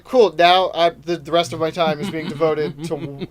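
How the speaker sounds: loud mock tirade; retold crowd scolding. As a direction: lay into the audience member, loudly. Acting out scolding of a heckler.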